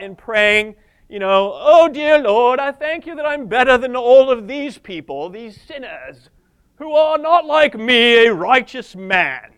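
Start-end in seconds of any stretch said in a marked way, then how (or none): 1.5-9.5 put-on British accent. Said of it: "I always do a British accent".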